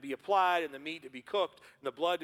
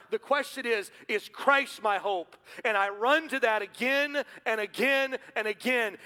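louder, higher preaching, building to a shout; preaching at full force; 7 semitones higher, 6 dB louder than usual. Acted. Pastor urging the congregation, full-force preaching. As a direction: drive it home to the church.

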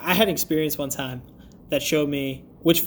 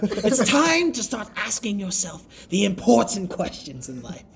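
mock-epic imitation; 4 semitones higher than usual. Mimicking the dramatic intro.

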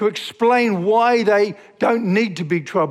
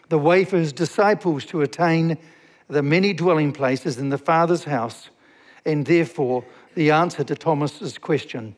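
usual speaking voice

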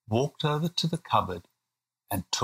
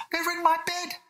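put-on very high alarmed little-boy voice; panicked child shout. A narrator's reading. William thinks lions are in his bed, panicked cry. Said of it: "shouted William".

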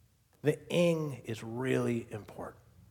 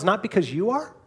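put-on higher, louder questioning child; Lucy; 5 semitones higher, 5 dB louder than usual. Girl questioning the lion about his size, high-voiced. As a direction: lift the pitch into an eager question. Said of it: "Lucy responds".

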